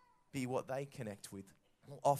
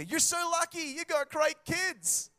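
mock envious; 8 dB louder than usual. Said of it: "someone will go".

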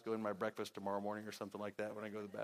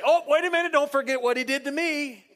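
much higher and louder indignant chime-in; piping up; 13 semitones higher, 12 dB louder than usual. Retold or imitated. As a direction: cut in eagerly to add your own grievance. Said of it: "Ron Kelly pipe up and say".

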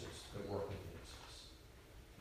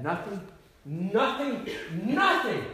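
nothing said three times, climbing higher and louder; building to a shout; 8 semitones higher, 10 dB louder, slower than usual. Real, not acted. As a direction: build each nothing to a cry. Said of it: "building repetition".

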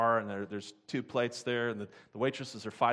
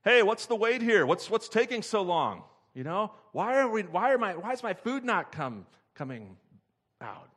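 high, loud impatient yell into the kitchen; mock yell of a fed-up waitress; yelling back; 8 semitones higher, 7 dB louder than usual. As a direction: holler at the cooks. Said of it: "the waitress yelling back".

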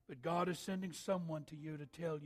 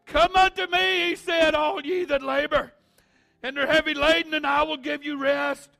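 much louder, higher, shouted scripture; shouted verse; 11 semitones higher, 18 dB louder than usual. Acted. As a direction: cry the verse out to the whole house. Repeats verse at climax.

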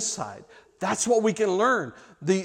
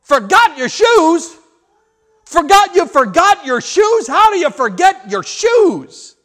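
high, loud, slow disbelieving yell; a mother losing it over forgotten shoes; exasperated yell; 10 semitones higher, 11 dB louder, slower than usual. Retold or imitated. Mother yells at her daughter about the shoes, exasperated. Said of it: "she yelled".

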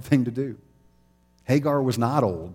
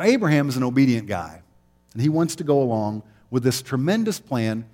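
normal talking voice

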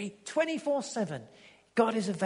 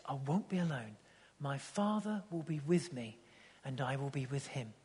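softer, reverent words of Jesus; hushed tender quote; 5 semitones lower, 5 dB quieter than usual. Retold or imitated. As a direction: say it gently, like a confidence.

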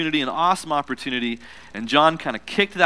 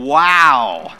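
louder wow; 4 semitones lower, 8 dB louder than usual. Shown as a demonstration. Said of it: "say it again".

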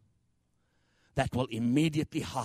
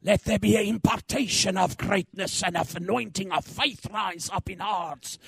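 ordinary talk

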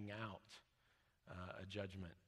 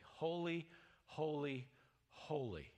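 "Holy, holy, holy" as three separate, weighty words, stepping down in pitch; emphatic threefold repetition; 3 semitones higher, slower than usual. Shown as a demonstration. Preacher showing repetition as emphasis, emphatic "Holy, holy, holy". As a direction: start the first "Holy" high, set each repeat apart. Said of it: "like, all caps with exclamation points".

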